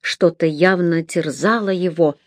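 ordinary delivery, nothing marked

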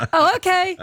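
high, hammy pilot reply; mock the out-of-his-depth passenger pilot; clueless pilot voice. Mimicking the pilot's radio reply.

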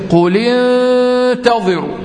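slow, drawn-out chanted recitation of قل انتظروا; measured Quranic chant. Shown as a demonstration. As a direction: recite it slowly like in tajweed. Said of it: "نحرك الساكن الأول بالكسر".

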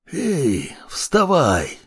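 rusty, barely-there croak; hoarse rasp. A narrator's reading. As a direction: rasp it out like a voice unused for ages. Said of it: "просипел он ещё не прорезавшимся голосом".

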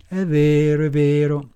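usual speaking voice

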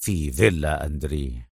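low, quiet resolve; deep, soft-spoken certainty; firm Adham. A narrator's reading. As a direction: state it decisively in a deep voice. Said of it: "ثم قال في حزم".

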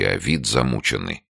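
ordinary delivery, nothing marked

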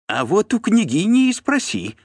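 high, loud cheeky retort; brazen. A narrator's reading. Steward sending the voivode to the princess, brazen. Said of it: "нагло ответил Тиун".

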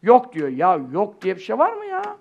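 ordinary talk

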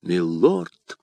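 low, questioning astonishment, rising at the end; поражённый возглас. A narrator's reading. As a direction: say it low, as a sudden realisation dawns. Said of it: "вскрикнул Д'Артаньян". (низко, изумлённо, с вопросом)

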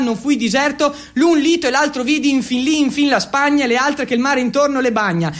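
fast, rushed recital of verse; reciting Dante in a hurry to impress; poem recital. Acted. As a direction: rattle off the verses from memory.

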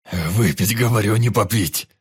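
loud, furious correction; angry shout. A narrator's reading. Раненый Пётр кричит на товарища, требуя выпить. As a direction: bellow it at the fool. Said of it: "Он снова перешёл на крик".

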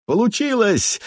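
very high triumphant cry; jubilant. Acted. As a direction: cheer with pure triumph. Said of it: "возликовал Пус".

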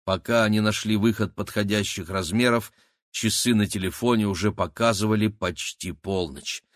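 plain reading voice

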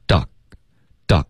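"doc-" cut off crisply before the next syllable; isolated "doc", stopped dead at the k; clean stop. Shown as a demonstration. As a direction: say "doc" and halt abruptly. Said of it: "然后会有一个干脆的停顿".

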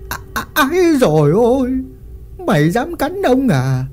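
yelling in pain. Acted. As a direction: howl after being bitten. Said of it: "lão Lý hét lên".

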